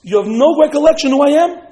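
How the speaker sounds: incredulous demand. Acted. Prime minister pressing the frightened man, incredulous. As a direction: press it loudly, astonished at being forgotten.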